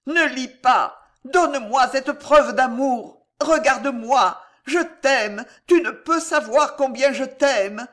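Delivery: high, loud desperate begging; horror and dread. A narrator's reading. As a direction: plead frantically with your lover. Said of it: "toute l'épouvante étaient dans la voix d'Alice".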